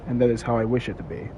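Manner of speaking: measured, philosophical narration; solemn monologue. Acted. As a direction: muse aloud like a film prologue. Opening philosophical monologue over music.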